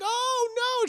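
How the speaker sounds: high-pitched dismayed cry; hammed-up distress; acted alarm. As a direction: exclaim in mock horror. Driver hears child crying.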